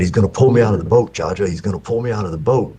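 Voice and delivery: put-on a scared kid pleading with grandpa, rushing; panicked child. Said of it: "terrified that this dang fish".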